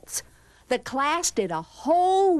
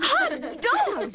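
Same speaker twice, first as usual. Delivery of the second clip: put-on high, gleeful kids' cheer; excited cry; 14 semitones higher than usual. A narrator's reading. Class celebrating the principal's exit, excited cry. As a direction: whoop it like delighted pupils. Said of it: "cried the class".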